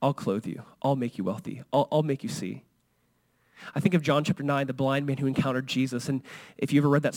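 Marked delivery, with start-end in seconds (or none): 0.0-2.6 soft, low, gentle promise; tender reassurance. Acted. Jesus answering the broken sinner, soft and tender. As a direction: comfort someone who feels worthless. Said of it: "And Jesus is like".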